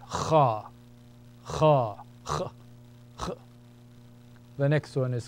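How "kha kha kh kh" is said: Kha scraped at the back of the throat; Arabic letter sound demo; grating kha. Shown as a demonstration. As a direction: rasp out Kha like clearing the throat. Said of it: "with a grating sound".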